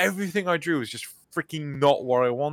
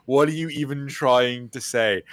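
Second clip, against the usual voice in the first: usual speaking voice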